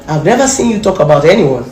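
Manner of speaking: usual speaking voice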